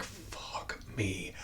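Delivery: breathless whispered curse; stunned whisper. Retold or imitated. Fan whispering in awe at the montage. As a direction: whisper it, floored by the moment.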